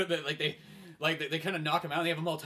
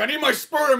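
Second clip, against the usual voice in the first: loud high demand; 11 semitones higher, 14 dB louder than usual. Acted. Don escapes the bunker yelling, high loud demand.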